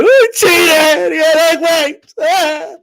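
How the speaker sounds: loud, high, hammed-up wailing; mock crybaby fans. Streamer mocks Wilder fans crying for two years, fake wailing. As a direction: bawl it out like a sore loser, exaggerated. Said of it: "crying like little bitches for two years".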